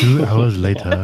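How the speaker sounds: slow, dramatic meme narrator; deadpan meme voice. Acted. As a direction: drag it out like a time-skip card. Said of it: "We have to do it with the meme voice".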